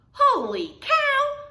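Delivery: loud drawn-out holler. Acted. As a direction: holler it in shock. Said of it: "hollered Manny the cook".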